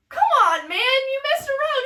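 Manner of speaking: very high, loud whine of disbelief; hammed-up incredulous protest; Romeo's protest. Romeo dismisses the wound.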